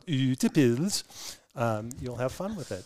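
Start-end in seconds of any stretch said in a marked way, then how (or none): 0.1-1.0 Ute Pilz playfully hammed up, Norwegian style; hammed-up pronunciation. Shown as a demonstration. Founder shows host how to have fun with the name, hammed-up Ute Pilz. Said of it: "if you can ham it up a little bit".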